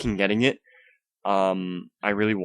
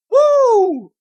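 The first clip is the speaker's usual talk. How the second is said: high shrill woo, full volume; forced cheer; 12 dB louder than usual. Shown as a demonstration. Woo to his viewers, whooped at full volume. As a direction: whoop it out like a hype host. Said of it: "I'm trying to do this enthusiastically".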